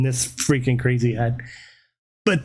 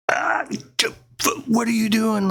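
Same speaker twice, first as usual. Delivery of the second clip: mock scream; 7 semitones higher than usual. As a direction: act out screaming in alarm at usual volume. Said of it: "Like, I'm screaming".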